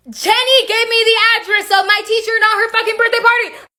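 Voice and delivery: high, sustained wail of disbelief; high-pitched indignation. Real, not acted. Teller revealing the wrong address prank, high indignant squeal. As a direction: squeal it out, aghast at the trick. Indignant punchline of betrayal story.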